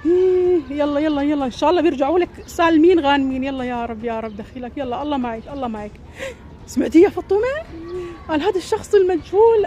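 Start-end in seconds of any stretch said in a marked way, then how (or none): 3.4-5.9 hushed prayer. Acted. Praying softly after bad news.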